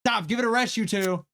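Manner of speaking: high, loud command to stop; stern shout to split a fight; shouting to break it up. Acted. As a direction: yell over two fighters.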